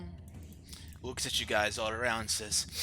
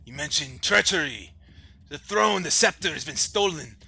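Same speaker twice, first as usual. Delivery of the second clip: loud, raised royal voice; troubled, demanding king; 6 semitones higher, 7 dB louder than usual. Acted. King to his rescuers about his daughter, troubled and demanding. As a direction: press for answers, high and forceful.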